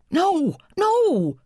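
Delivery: put-on very high-pitched frantic kid protest; shrieky high refusal, not very loud; sputtering boy. A narrator's reading. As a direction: protest like an overwrought little boy. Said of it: "Charlie sputtered".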